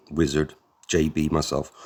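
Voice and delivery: ordinary delivery, nothing marked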